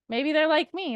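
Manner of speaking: normal talking voice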